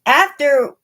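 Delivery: plain reading voice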